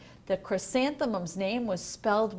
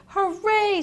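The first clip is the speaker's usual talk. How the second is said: high, loud happy cheer; a child cheering on the way to school; a joyful shout of hooray; excited cheer; 10 semitones higher, 7 dB louder than usual. A narrator's reading. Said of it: "She ran all the way".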